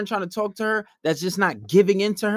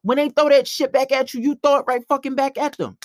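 heated comeback; 7 semitones higher than usual. Retold or imitated. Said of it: "I was like".

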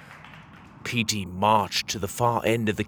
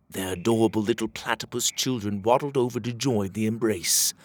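usual speaking voice

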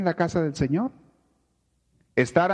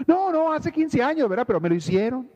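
aggrieved whine; 9 semitones higher than usual. Retold or imitated. Preacher imitates someone nursing a fifteen-year grudge, whiny and high. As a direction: play the wounded victim who won't let go. Person clinging to old grudge.

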